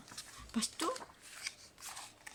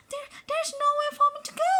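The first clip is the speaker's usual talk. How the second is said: much higher, fast panicky little voice; crying elephant; 7 dB louder, faster than usual. A narrator's reading. Little elephant cries to friends, high and fast. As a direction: squeak it out in a tiny frightened voice. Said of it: "cries little elephant".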